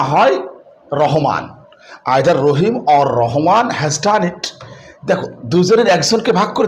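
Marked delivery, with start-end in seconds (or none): 2.2-4.6 very slow example sentence; teacher dictating the example sentence slowly; slow dictation. Shown as a demonstration. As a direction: read each word slowly for the class. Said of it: "যদি এভাবে বলি".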